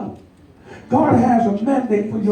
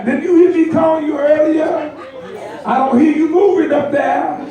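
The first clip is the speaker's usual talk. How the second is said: parent calling upstairs; 6 semitones higher than usual. Retold or imitated. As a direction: holler up the stairs at the kids. Parent calling kids again.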